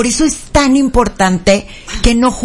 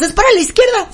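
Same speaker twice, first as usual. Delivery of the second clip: retold chorus; 7 semitones higher, faster than usual. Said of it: "Las dos decimos".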